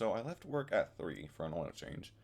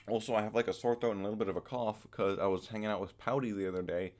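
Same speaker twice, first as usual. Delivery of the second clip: normal talking voice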